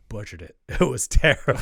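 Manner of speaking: real laugh breaking into the words. Real, not acted. Guest laughing at how he butchered his first song. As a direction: laugh it out, embarrassed.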